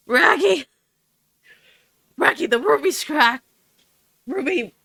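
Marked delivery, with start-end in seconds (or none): 0.1-3.4 lightly voiced cartoon dog; a hint of Scooby growl; Scooby-Doo voice. Shown as a demonstration. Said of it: "the closest I can do ... Scooby".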